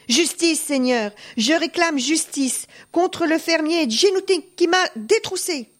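high, urgent plea for justice; crying out. A narrator's reading. Peasant begging the lord for justice, crying out. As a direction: cry out to a great lord, voice pitched high. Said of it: "se jeta à ses pieds et cria".

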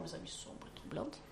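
teasing whisper. Shown as a demonstration. Host whispers the phrase to her co-hosts, teasing. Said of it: "sa voix sombre et troublante".

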